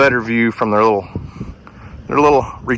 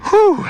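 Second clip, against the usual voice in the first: winded whew. Real, not acted. Exhales after steep climb.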